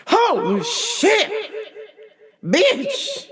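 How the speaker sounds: high, drawn-out mock panic; panicked outburst. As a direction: yelp it in comic panic. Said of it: "I panicked".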